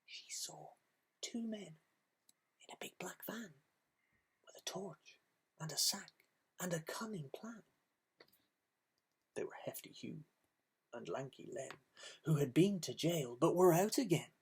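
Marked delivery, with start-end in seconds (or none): 0.0-11.8 soft whisper, as if sharing a secret; spooky whisper introducing the thieves; hushed storytelling. A narrator's reading. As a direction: lean in and whisper to the children. Narrator hushes for the villains.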